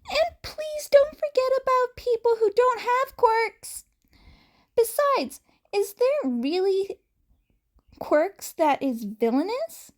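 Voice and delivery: put-on earnest small boy asking innocently; innocent child voice. A narrator's reading. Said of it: "tilted his head innocently".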